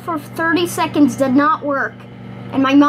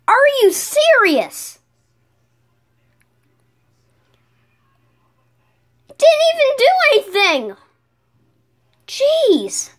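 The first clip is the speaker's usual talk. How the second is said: exasperated outburst; 11 semitones higher than usual. Real, not acted. Kid venting to camera, high exasperated complaint. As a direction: throw your hands up at it. Microwave experiment fails again.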